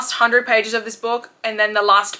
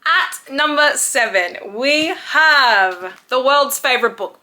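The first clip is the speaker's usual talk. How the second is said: slow, louder host voice announcing the entry; countdown announcer; 4 semitones higher, 5 dB louder, slower than usual. Acted. Vlogger announcing her ranked book to viewers, slow and louder. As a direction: present the next pick with flair.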